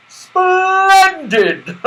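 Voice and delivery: high, loud delighted exclamation with a laugh; jolly Santa delight. A narrator's reading. Santa thrilled by the slippery slip, delighted laugh. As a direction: burst out in merry delight. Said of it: "said Santa Claus".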